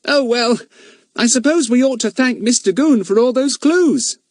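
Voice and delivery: high, mock-grateful boy; cheeky with a wink in the voice; sly teasing. A narrator's reading. Fatty slyly mocks Goon before the others, teasing.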